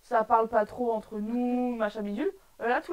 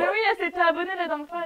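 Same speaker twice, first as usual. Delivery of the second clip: laughing; 7 semitones higher, 6 dB louder, faster than usual. Real, not acted. Laughing while explaining subscriber.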